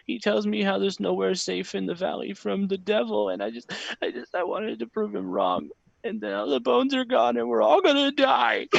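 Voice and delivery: put-on high, tearful child voice, building to a shout; a boy spiraling into terror; boy wailing that everyone will die; panicking boy.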